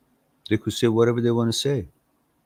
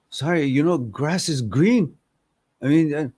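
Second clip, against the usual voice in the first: sheepish tiger; 7 semitones higher than usual. Acted. Tiger meekly answers the lion.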